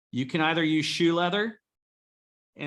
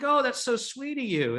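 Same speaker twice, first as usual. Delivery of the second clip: higher, gushing receptionist voice; sweet receptionist; 9 semitones higher than usual. Retold or imitated. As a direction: imitate the flattered receptionist, lifted and sweet. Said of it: "And they're like".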